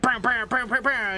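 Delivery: high-pitched mouth-made gunshots; imitated gunfire. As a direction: rattle off cartoonish gun noises. Imitates Western-style gunfire.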